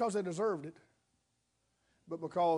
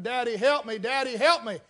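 mock scream; 4 semitones higher, 7 dB louder than usual. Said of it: "And she's screaming".

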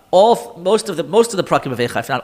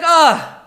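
high, loud moan, demonstrated; demo moan; 9 semitones higher, 6 dB louder than usual. Shown as a demonstration. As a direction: moan it out, overwhelmed. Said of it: "the best word would be a moan".

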